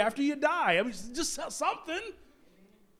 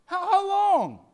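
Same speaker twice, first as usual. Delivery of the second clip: higher, louder desperate cry of how long; anguished cry; 11 semitones higher, 8 dB louder, slower than usual. Acted. Prophet's anguished plea, raised.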